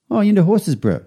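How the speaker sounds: a big guy lighting up at the word horses; tattooist voice, surprised. Retold or imitated.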